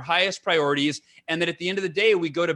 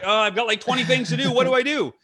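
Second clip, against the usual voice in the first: mock-frazzled, higher-pitched self-quote; scattered; 6 semitones higher than usual. Entrepreneur recalling himself in his office facing the day, scattered. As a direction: act out being lightly overwhelmed, pitch climbing. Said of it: "I'd be scattered".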